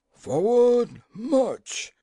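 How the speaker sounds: high-pitched officer's yell; loud, high parade-ground call; yelled command. A narrator's reading. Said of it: "yelled the colonels".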